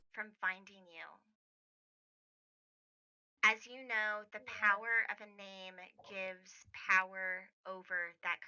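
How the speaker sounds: a hidden protector quietly warning Lucinda; soft mysterious voice. Acted. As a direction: speak gently like an unseen guardian.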